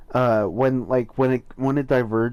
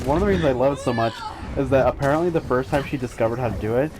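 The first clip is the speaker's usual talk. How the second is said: ordinary delivery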